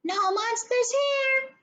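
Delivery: high-ish troll voice from the closet; medium-sized voice. A narrator's reading. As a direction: answer like a middling creature. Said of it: "said a medium-sized voice".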